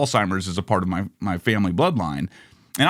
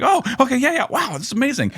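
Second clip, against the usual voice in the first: much higher, breathless, dazzled fan; starstruck fan. Retold or imitated. Contestant thrilled to play with Tony Randall, high dazzled gushing. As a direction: gush over meeting a star. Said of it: "It's like".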